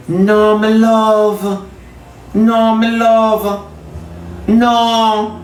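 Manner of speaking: high, stretched-out no; drawn-out refusal. Acted. Preacher refusing to go back every week, high drawn-out refusal. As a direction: hold the words long in firm refusal. Acted refusal to keep returning.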